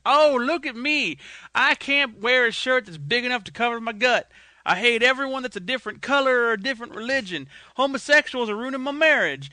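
lampoon a bigoted Texan loudly; mocking Texan. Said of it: "I would have been".

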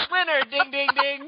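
high, loud game show call; excited announcer. Pretend. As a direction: shout it like a quiz host. Game show buzzer joke.

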